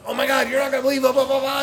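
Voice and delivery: high, loud, fast gushing; ranting excitedly. Retold or imitated. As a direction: rave to girlfriend in a rush. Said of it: "I'm ranting and raving in a great way".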